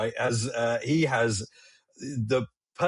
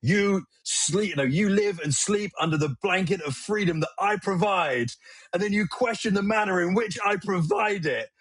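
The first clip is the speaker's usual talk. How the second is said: Jack Nicholson impression; 6 semitones higher than usual. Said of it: "he is Jack Nicholson in A Few Good Men".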